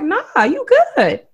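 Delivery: high, drawn-out friend's reply; stretched, high-pitched imitation of a homegirl; imitated homegirl. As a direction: mimic a friend's breezy reassurance, slow and high. Voices homegirl's reassuring answer.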